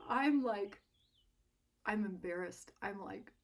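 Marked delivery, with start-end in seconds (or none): none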